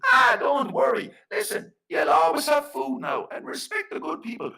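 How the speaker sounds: high, shouted fairy voice; fairy man. Acted. Fairy man tells Flanagan not to worry, high and loud. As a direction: brush off the apology at the top of your voice.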